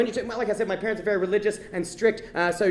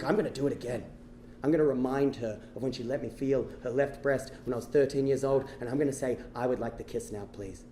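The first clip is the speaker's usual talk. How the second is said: low, soft inner voice, fading at the end; hushed resolve; 10 semitones lower, 9 dB quieter than usual. Acted. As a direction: rehearse the big line to yourself, very quietly. Secretly rehearsing his big line.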